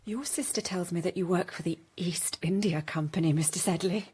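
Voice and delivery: low intimate whisper. Acted. Becky flattering Joseph in a low whisper. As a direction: hush it, flirting close. Becky flirting in hushed tones.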